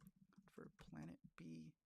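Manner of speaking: slow, soft whisper. Real, not acted. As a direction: breathe the title quietly. Title said again, half whispered.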